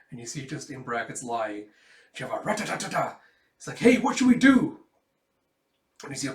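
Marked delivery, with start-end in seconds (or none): 3.8-4.8 shouting. Acted. Soldiers under fire.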